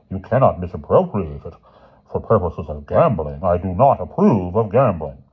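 measured, weighty scolding voice; stern, emphatic authority; stern headmaster. A narrator's reading. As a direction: lecture the boys as their headmaster.

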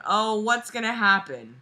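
ordinary talk

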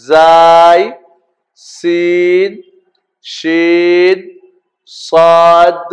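drawn-out, level-pitched letter names; alphabet recitation. Shown as a demonstration. Teacher leading learners through the Arabic alphabet, measured recitation. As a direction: hold each Arabic letter name on a steady note.

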